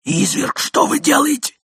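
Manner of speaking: ordinary delivery, nothing marked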